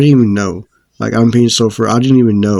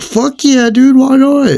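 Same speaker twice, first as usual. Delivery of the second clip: much higher, louder eager squeal; thrilled answer when the teacher offers to prove she smokes; excited yes; 5 dB louder, slower than usual. Retold or imitated.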